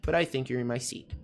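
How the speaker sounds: "But I think you're in my seat" run together; connected pronunciation model. Shown as a demonstration. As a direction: link the whole sentence softly in one go. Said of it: "Coisinhas importantes com relação à pronúncia".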